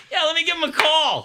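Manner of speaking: high-pitched, gleefully naive voice; mock eagerness. Son mocking mom's Stallone advice, high mock eagerness. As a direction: pitch it way up, comically keen.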